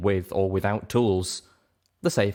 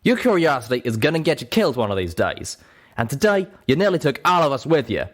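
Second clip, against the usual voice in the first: louder, higher scolding; angry scolding; 7 semitones higher, 4 dB louder than usual. Acted. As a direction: warn her sharply. Calamity still angry.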